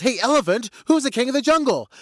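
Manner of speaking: high, loud challenge from a cocky lion; insistent lion. Acted. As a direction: demand an answer, pitched right up. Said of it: "He says".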